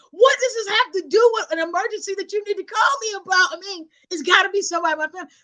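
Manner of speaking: high, hurried, incredulous questioning; exasperated confusion. Retold or imitated. Grandchild questioning the emergency, acted exasperation. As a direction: re-enact impatient bewilderment.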